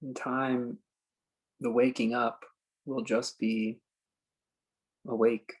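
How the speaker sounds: slow, spacious meditation guide with long pauses; calm phrases left hanging in silence; guided meditation. Acted. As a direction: ease them into stillness, unhurried.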